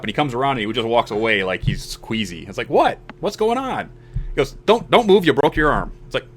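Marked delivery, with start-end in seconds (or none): 2.7-3.9 higher-pitched mock bafflement; retold alarm. Hurt player asks his buddy what's happening, retold alarm. 4.7-5.9 higher-pitched acted urgency; retold urgent warning. Buddy warns the injured player, retold urgent warning.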